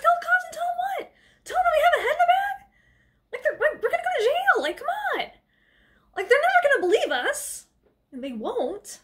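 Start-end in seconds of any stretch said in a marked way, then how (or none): 0.0-7.7 very high, rapid, whiny panic; mock hysteria. [name] argues with Maya about the cops, mock hysteria. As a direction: rattle it off in a hammed-up squeal.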